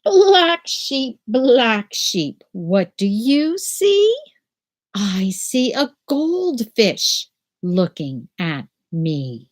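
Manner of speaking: normal talking voice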